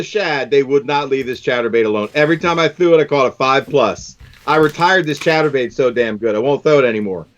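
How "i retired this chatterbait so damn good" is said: ordinary talk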